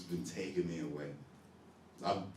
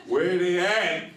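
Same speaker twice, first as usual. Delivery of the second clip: laughing; 11 semitones higher, 11 dB louder, slower than usual. Retold or imitated. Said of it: "until I was like".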